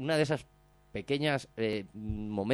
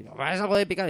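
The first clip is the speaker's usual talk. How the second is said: higher, louder put-on voice; a cheeky biker at the kitchen door; mock request; 8 semitones higher, 6 dB louder than usual. (petición burlona con voz aguda)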